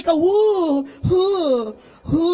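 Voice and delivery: hammed-up fake sobs; mock sobbing. Priest mocking the careless faithful with fake sobs. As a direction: sob it out in mock grief.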